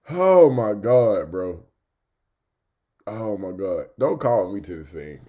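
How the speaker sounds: ordinary delivery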